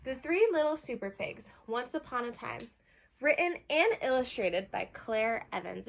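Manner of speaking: lively read-aloud for a class; bright picture-book reading; reading to children. Acted. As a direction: announce the title like storytime. Teacher reads the title page.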